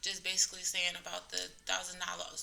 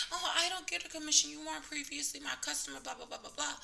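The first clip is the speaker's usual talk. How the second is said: higher, prissy imitation trailing into blah blah; mock indignant staffer; 7 semitones higher than usual. Coordinator denies the commission to the patient, higher mock-prissy voice. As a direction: mimic her protesting, then fade out dismissively.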